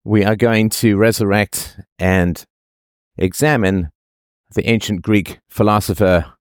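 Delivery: usual speaking voice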